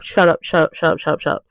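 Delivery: low, soft muttering that fades away; muttered repetition. Pretend. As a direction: repeat it under the breath, dropping low and quiet. Repeated plea fading away.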